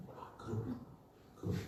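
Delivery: breathy whisper of sudden understanding; hushed, wondering whisper; whispered realization. Real, not acted. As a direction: murmur it to yourself as it dawns. Quiet private realization.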